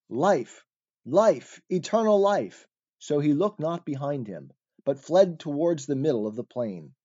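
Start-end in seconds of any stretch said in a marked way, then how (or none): 0.1-2.7 higher-pitched, restrained cry at the end. A narrator's reading. Fleeing man calls out as he runs, mildly crying out. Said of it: "ran on crying".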